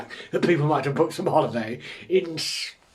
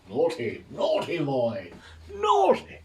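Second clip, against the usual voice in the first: mock scolding; 3 semitones higher, slower than usual. Streamer teasing viewer unready for Christmas, mock scolding. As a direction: tut slowly, then squeal the last word.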